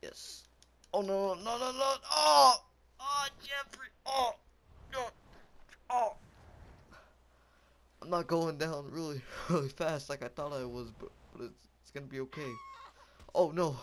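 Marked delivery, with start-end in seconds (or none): 0.9-6.1 high, loud panicked cries; screaming. Real, not acted. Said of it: "I'm screaming". 13.4-13.8 loud, raised cry of alarm; screaming. Real, not acted. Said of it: "I'm screaming".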